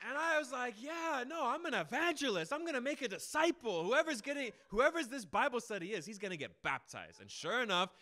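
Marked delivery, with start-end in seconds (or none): 0.8-7.2 high, loud swagger; confident boast. Retold or imitated. Preacher meeting a new student, boasting he will baptize him, loud and high. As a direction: declare the win before it happens.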